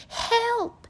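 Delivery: high, loud desperate yell; panicked witch shouting help; cry for help. A narrator's reading. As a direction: scream for help.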